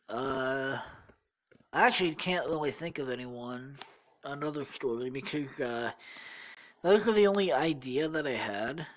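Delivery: ordinary talk, nothing marked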